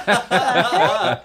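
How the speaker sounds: loud, drawn-out mock surprise; hammed-up slow astonishment; mock bafflement. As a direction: act out being baffled, half laughing. Acts out surprise at stuck fork.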